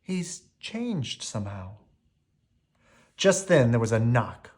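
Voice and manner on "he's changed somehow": quietly. A narrator's reading. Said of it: "said Belle quietly".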